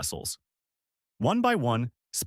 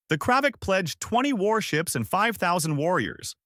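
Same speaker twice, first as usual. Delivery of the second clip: high-pitched general's shout to the chamber; proud shout; 9 semitones higher than usual. A narrator's reading. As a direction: call out the pledge in a raised, higher voice. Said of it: "shouted General Zartok".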